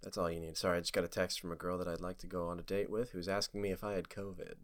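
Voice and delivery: low, very soft late-night radio murmur; quiet, low-pitched mock radio host; low soothing voice. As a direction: soothe drivers with a low, hushed voice. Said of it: "If you have a low voice and you can really soothe people".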